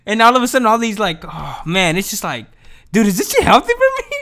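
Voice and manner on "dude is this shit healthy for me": shrill-sounding panicked question, much higher; real worried yelp, pitched way up; alarmed squeak. Real, not acted. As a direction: voice jumps way up in disbelief. Sudden alarmed self-question.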